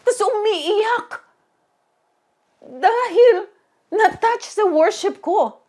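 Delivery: high, loud and slow with wonder; moved emphasis. Real, not acted. Preacher telling the congregation, high and stirred. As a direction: press it home, amazed.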